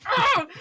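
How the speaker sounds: short, high, loud mock scream; exasperated mock scream. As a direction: cry out in playful exasperation. Reacts to being asked constantly.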